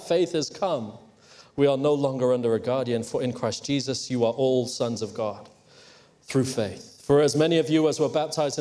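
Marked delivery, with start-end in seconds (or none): none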